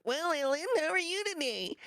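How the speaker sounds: very high, squeaky cartoon chicken; high-pitched put-on poultry voice; talking chicken. Shown as a demonstration. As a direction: squawk it out as a chatty cartoon chicken. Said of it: "one of the characters is this talking chicken".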